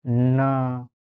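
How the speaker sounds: ना held long on its vowel; drawn-out vowel. Shown as a demonstration. Teacher showing the vowel sound ending ना, drawn out. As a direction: stretch the end of ना. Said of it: "last में अ का उच्चारण आ रहा है".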